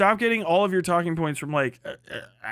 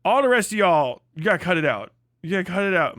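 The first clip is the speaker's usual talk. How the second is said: ordinary talk, nothing marked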